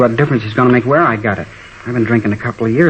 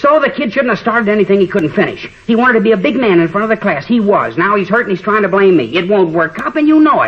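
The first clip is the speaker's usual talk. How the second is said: defiant anger; 8 semitones higher than usual. Acted. Teen defies police in interrogation.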